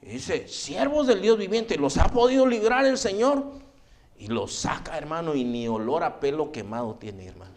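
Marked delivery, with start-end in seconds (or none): 0.5-3.4 high-pitched, stretched call, acted out. King asks the three in the furnace, calling out. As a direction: hail them from afar as the king.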